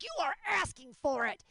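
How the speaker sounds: high, emphatic mock scolding; hammed-up outrage at a pet; mock indignant. Owner scolding her cat for teasing, mock indignant. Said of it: "and I'm like".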